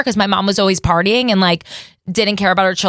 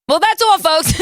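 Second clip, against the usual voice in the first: cartoon sign-off; 8 semitones higher than usual. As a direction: belt it like a cartoon closing card.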